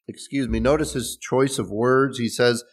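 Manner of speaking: ordinary delivery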